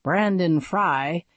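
ordinary delivery, nothing marked

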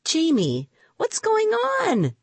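high, insistent question; demanding. A narrator's reading. Rima wants an explanation from Jamie, demanding. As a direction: stretch it out and climb in pitch. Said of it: "demanded Rima".

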